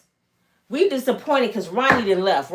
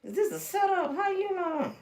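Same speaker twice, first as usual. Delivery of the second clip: suspicious; 7 semitones higher, 8 dB quieter than usual. Retold or imitated. Suspicious girl.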